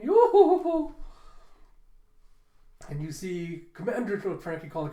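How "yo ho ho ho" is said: high, loud theatrical chortle; hammed-up skeleton belly laugh; Brook's laugh. Brook teasing Frankie, high boisterous cackle.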